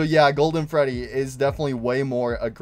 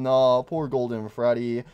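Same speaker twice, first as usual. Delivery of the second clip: ordinary talk, nothing marked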